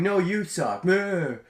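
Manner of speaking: bratty high taunt; childish. Retold or imitated. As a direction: sneer it like a sulking kid. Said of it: "which is a lot more childish".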